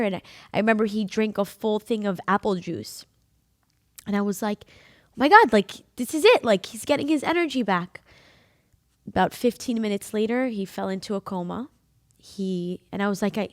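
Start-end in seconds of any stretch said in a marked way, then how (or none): 4.7-8.0 loud, high thrilled rush; retelling a hopeful outburst; excited hope. Retold or imitated. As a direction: burst out believing he's recovering. Retold joy at dad's recovery.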